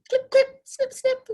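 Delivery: high snipping lobster voice; snapping lobster. Acted. Teacher plays the lobster snipping fingers, high and playful. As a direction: snip snip like little claws.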